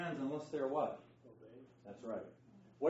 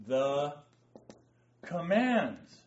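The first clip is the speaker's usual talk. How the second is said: ordinary talk, nothing marked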